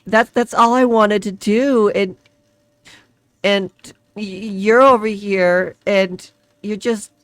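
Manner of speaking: high, loud and slow, building to tears; distressed pleading. Acted. Woman breaking down before the hooded figure, crying. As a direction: pour it out until the voice cracks into crying. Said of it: "she just kinda breaks down and starts crying".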